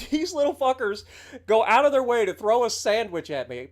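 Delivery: normal talking voice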